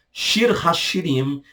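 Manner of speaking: usual speaking voice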